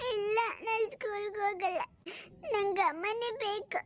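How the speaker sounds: put-on whiny, tearful little-boy voice; crying child. Boy refuses school, wanting mother, tearful. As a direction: drag the words out in a child's sob. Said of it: "ಯಾಕೆ ಅಳ್ತಿದ್ದಿ".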